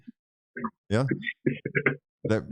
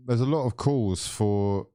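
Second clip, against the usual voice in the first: ordinary talk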